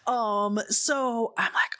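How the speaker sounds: ordinary talk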